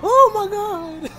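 high, slow shriek that breaks into a laugh; real shock spilling into laughter; Oh my God drawn out high, then a laugh. Real, not acted. Startled by a crash in the game.

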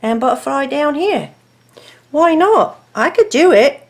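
hammed-up English accent, lively and high. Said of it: "I have an English accent all, all of a sudden".

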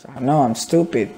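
ordinary delivery

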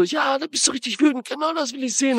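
high-pitched imitation of egging someone on; mock goading shout. The coach eggs on his angry driver, mock shouting. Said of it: "schreit er so".

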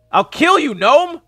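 high, loud mock-furious yell; shouted threat. Player threatening a game gnome, shouted mock threat. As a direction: hurl a threat at the top of your voice.

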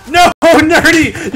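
high, loud, stretched-out wail through laughs; laughing cry. Real, not acted. Gamer yelling at the rival who killed him, laughing. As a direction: wail the name while laughing.

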